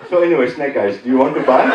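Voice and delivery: put-on earnest Indian-accented sales pitch; imitated Indian phone salesman. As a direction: pitch it as a mock Indian telemarketer. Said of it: "most of them are Indian".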